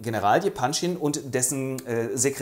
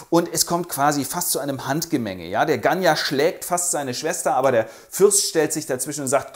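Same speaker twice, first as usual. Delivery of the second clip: normal talking voice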